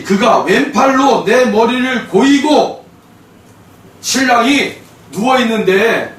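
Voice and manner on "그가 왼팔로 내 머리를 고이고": 1 loud slow reread. Shown as a demonstration. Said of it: "다시".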